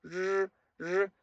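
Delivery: "r" as a soft held buzz without trill; high, drawn-out buzzing "r", tongue back. Shown as a demonstration. Teacher contrasting with Spanish, soft buzzed "r". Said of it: "un sonido más suave".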